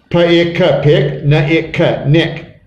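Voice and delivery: Pa-ik-ka, pick, Na-ik-ka, nick, sound by sound; teacher blending separate sounds, hard k ending. Shown as a demonstration. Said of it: "the way this word is sounded out".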